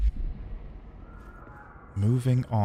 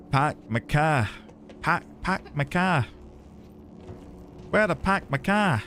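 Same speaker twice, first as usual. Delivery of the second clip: put-on repeated tries at a Boston accent, no r's; mock Boston accent; 3 semitones higher than usual. Streamer keeps practising a bad Boston accent. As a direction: keep fumbling for the Boston sound. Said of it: "it's so bad".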